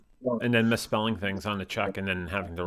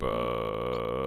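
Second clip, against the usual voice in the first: vocal fry; 12 semitones lower, 6 dB quieter than usual. Shown as a demonstration.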